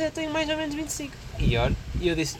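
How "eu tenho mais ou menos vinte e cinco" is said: much higher, musing voice; imitating the saleswoman's doubt; pondering saleswoman. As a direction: think it over, unsure.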